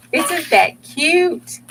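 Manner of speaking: high, slow, squealing delight; high drawn-out isn't that cute. Real, not acted. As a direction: coo over the cute page. Admiring her craft page.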